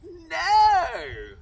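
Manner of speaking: high, very loud scream of horror. Acted. Prince when the sister's foot fits, high very loud scream. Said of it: "The prince screamed".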